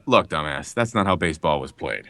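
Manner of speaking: low in-character line reading; famous game line. Acted. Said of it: "another famous line".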